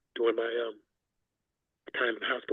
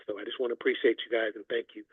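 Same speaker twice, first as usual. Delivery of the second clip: ordinary talk, nothing marked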